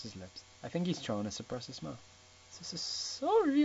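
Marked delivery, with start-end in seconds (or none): 2.6-3.6 high, slow stammered sorry; stutter. Acted. Ana stammering to Mister Gray, high and slow. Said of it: "I stutter".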